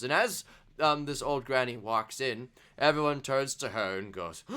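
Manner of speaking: ordinary talk, nothing marked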